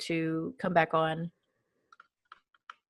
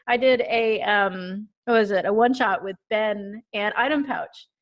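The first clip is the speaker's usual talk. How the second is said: ordinary delivery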